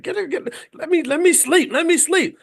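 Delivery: wife imitating her husband grumbling in his sleep; sleepy growling. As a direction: whine it like a half-asleep man being shaken awake. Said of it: "you started growling".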